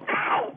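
very high, sudden "ow"; sharp high howl of pain; yelp of pain. Acted. Guest hurt while showing off, yelping. Stamps on injured foot, yelling.

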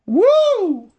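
loud, high whoop for emphasis; ear-splitting woo. Real, not acted. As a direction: whoop WOO to drive the point home. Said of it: "That almost blew my ears out".

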